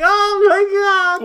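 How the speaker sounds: very high, hammed-up cry; mock exclamation. Imitating the game character's reaction, high mock cry. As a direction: squeal it out in mock astonishment.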